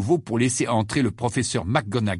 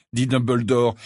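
ordinary delivery, nothing marked

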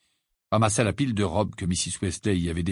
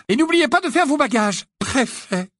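much higher, proud beaming mother; a mum bursting with pride; mother beaming over her prefect son, voiced high; high gushing mother; 5 dB louder than usual. A narrator's reading. Mrs Weasley voiced high.

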